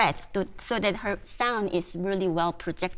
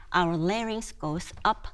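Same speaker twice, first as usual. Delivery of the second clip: usual speaking voice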